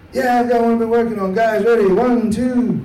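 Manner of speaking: put-on hammy British accent counting in the band; British rock singer counting off, loud and put-on; bad British accent. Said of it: "he's British ... I don't do a great British accent".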